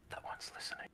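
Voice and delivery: hushed warning, barely voiced; urgent whisper. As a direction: whisper so the eavesdropper won't hear. Akari warning about the eavesdropper.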